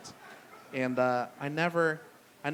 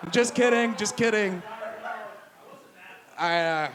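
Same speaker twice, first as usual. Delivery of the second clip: laughing; 3 semitones higher than usual. Real, not acted. Laughing off a joke.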